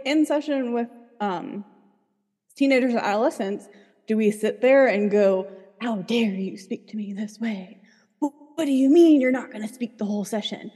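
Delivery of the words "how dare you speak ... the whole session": mock indignation. Therapist mock-scolding a teen who resists the session, indignant. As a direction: act the outraged authority, exaggerated.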